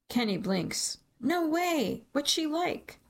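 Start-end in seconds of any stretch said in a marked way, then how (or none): 1.2-3.0 much higher, eager disbelief; amazed. A narrator's reading. Kenny learns who the patient is, excited and high. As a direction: gasp it out, hungry for gossip.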